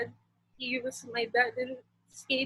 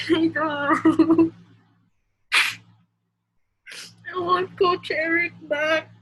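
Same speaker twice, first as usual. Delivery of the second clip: tearful voice breaking into sobs; crying through the words, barely holding on; crying, worn out; 2 semitones higher, 5 dB louder than usual. Real, not acted. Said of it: "I can't stop crying".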